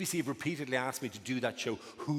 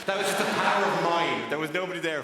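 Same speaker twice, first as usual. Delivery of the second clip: loud punchline; 4 semitones higher, 7 dB louder than usual. Real, not acted.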